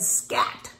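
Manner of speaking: breathy unvoiced "Scat"; whispered scat. Hen shooing the duck off, whispered. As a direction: shoo the duck away in a hushed whisper.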